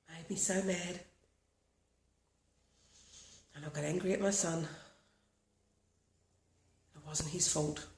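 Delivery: walk them through tapping calmly, line by line; guided tapping script. Acted. Leads a tapping routine.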